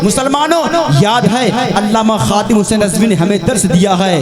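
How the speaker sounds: high, ringing sermon delivery; impassioned preaching. Real, not acted. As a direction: preach it with mounting fervour in rolling phrases. Preaches at full force.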